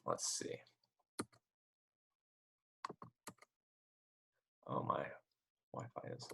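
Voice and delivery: choppy, cutting-out connection audio. Real, not acted. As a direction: voice dropping out on bad Wi-Fi. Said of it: "My audio keeps cutting out".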